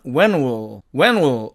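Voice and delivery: "when will" run together as "wen-u"; contracted when'll. Shown as a demonstration. Teacher shortening when will, contracted. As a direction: blend "when will" with no clear L. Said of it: "이렇게 발음이 되고".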